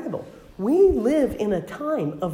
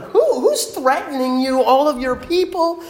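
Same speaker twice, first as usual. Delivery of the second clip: indignant king; 9 semitones higher, 5 dB louder than usual. Acted. King demands to know the threat.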